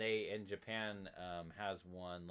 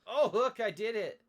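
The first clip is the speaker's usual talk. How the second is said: high, loud victory shout; triumphant; 16 dB louder, slower than usual. Real, not acted. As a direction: crow over a win, stretching the end. Celebrating success in the game.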